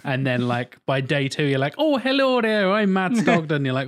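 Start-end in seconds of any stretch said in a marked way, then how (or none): 1.8-3.6 high, loud Irish lilt; Irish accent. Retold or imitated. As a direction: exaggerate an Irish accent, pitched way up. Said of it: "able to do an Irish accent yourself".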